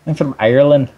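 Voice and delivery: a hammed-up Irish accent that slides into Scottish; mock Irish accent. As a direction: fake a brogue, badly, as a joke. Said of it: "that was more Scottish".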